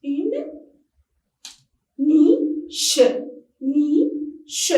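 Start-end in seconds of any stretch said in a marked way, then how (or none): 2.0-4.7 NICHE broken in two, ni, che; split into syllables. Shown as a demonstration. Teacher showing pupils the syllables, niche split in two. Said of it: "Nous avons combien de syllabes ici".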